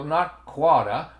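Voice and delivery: "quota" bez R, po bostońsku, przeciągnięte; Boston quota. Shown as a demonstration. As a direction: say "quota" with a dropped R, slow Boston style.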